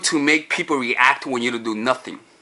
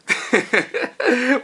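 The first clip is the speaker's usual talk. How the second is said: high burst of surprised laughter; real laugh; 7 semitones higher than usual. Real, not acted. Laughing at her own broken sandal, high burst.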